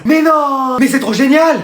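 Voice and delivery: high, excited exclamation; thrilled disbelief. Acted. Pupil hears a Spanish girl is joining class, thrilled. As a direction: burst out delighted, stretching the first exclamation.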